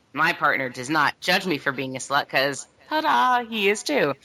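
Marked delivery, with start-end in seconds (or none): 2.9-4.2 ta-da flourish, lifted higher and bright; sing-out higher reveal; playful reveal. Real, not acted. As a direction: announce it like a magic trick. Playful reveal about her partner.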